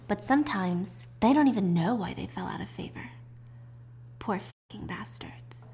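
acted confiding murmur, fading very soft; hushed aside. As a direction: drop into a near-whisper.

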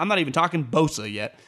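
ordinary talk, nothing marked

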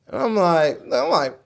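usual speaking voice

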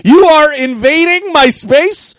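very high, slow, loud indignation; mock outrage. High guy repeating his rebuff to partner, outraged. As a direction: shout the accusation word by word. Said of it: "he thought I was serious".

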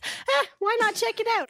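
high, tossed-off suggestion; casual why-not, voice lifted high; breezy nudge. Real, not acted. Pitching the contest to listeners.

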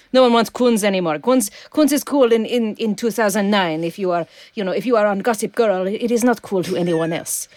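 put-on French-accented art thief drifting into Russian; Melinda LaFleur. Art thief showing loot to tied-up detective, wobbly French accent. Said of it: "I can attempt the French".

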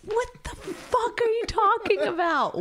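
high, slow, laughing incredulous; laughing disbelief. Real, not acted.

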